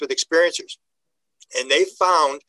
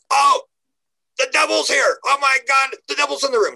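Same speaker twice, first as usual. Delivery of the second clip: high, panicky gasping alarm; retelling a terrified hypnosis client, voice shooting up high; frightened cry; 9 semitones higher than usual. Retold or imitated. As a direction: blurt it out like you see the devil. Regressed client sees the devil.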